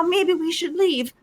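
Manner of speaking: slow, soft, understated suggestion; mock calm. Player mimics the woman after heavy breathing, mock calm. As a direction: imitate someone utterly unruffled. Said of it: "and then they're calmly".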